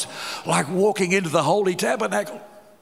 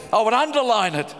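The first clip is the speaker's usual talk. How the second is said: ordinary talk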